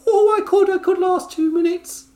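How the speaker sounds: put-on high, loud Scottish accent; squeaky Scottish wrestler voice, pushing back; Nikki Cross impression. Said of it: "my Nikki Cross impression".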